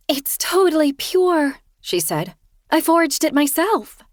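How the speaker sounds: high, sheepish confession voice; Kirsten sheepish. A narrator's reading. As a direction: voice her high and apologetic.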